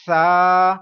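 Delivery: سا said loud with no hiss; teacher shows سا without a whistle. Shown as a demonstration. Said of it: "پڑھیں گے اس حرف کو؟".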